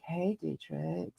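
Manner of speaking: very soft, slow sultry greeting; sultry woman voice. Shown as a demonstration. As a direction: purr it quietly, drawn out. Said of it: "my sultry woman voice".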